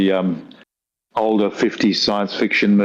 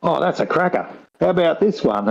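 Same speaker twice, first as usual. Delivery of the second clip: imitating the AI, higher and eager; 8 semitones higher than usual. Mimicking the AI as it dredges up old jokes, eager and higher. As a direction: play the keen AI offering joke after joke. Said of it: "you know, it's going".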